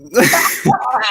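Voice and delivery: loud high laugh; big burst of laughter. Real, not acted. Laughing at the bald joke.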